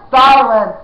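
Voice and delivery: loud sharp command. A narrator's reading. Jemmy silences the prince with a loud command. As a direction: cut him off hard. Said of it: "Jemmy commanded".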